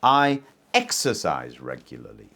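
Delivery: "exercise" in "I exercise regularly" given the weight; stress on exercise. Shown as a demonstration. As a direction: lean on "exercise", not other habits. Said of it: "I don't just do other things".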